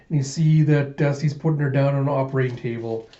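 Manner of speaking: usual speaking voice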